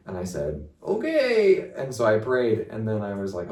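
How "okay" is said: nervous okay. Retold or imitated. Nervous reply after self-doubt.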